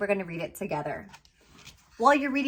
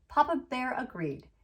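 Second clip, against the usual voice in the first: plain reading voice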